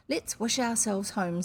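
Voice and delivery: plain reading voice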